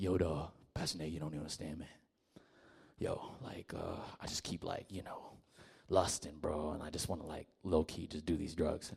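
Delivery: put-on low, soft mumbling young guy; mock confessing dude. Young man confessing to his pastor, low and hushed. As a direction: act out a guy confessing sheepishly. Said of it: "Dudes come over and be like".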